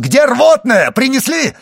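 high, loud urgent shout. A narrator's reading. Villefort shouts for the emetic from outside, urgent yell. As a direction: shout it through the house. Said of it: "выбежал из комнаты и крикнул".

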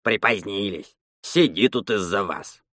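put-on low, subdued old man's grumble; grumbled. A narrator's reading. Old bathhouse man to late arrivals, grumbling. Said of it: "он буркнул".

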